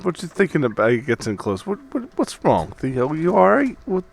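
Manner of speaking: quiet. Acted. Gets in close to Theo.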